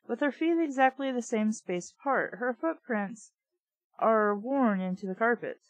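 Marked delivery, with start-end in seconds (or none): none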